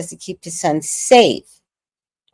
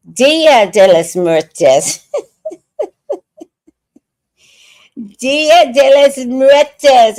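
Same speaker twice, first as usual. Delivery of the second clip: loud, slow, drawn-out announcement; hammed-up exclamation; 7 semitones higher, 7 dB louder, much slower than usual. Real, not acted. Proclaiming her favorite holiday, hammed-up exclamation. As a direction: belt it out slowly through laughter.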